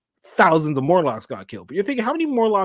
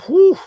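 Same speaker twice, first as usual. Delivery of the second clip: a high, loud whooshing blast noise; mock explosion sound effect; imitated explosion whoosh; 10 dB louder than usual. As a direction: make the sound of a big explosion.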